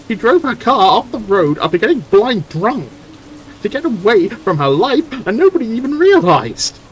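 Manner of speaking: raging at a whole town's hypocrisy; angry outburst. Acted. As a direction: shout her grief and rage. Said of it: "I'm just really angry again".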